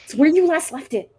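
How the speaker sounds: high, loud call to the next room; yelling across the room. Real, not acted. Answering child across room.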